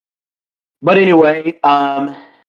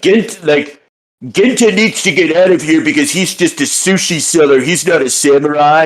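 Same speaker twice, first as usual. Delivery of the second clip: high-pitched mock complaint; Tanba; 4 semitones higher than usual. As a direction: imitate a haughty character dismissing someone. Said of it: "Tamba is like, you know, he's like".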